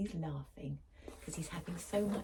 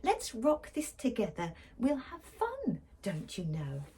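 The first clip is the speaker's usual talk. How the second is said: little mouse; 6 semitones higher than usual. Acted. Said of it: "Mouse peeped".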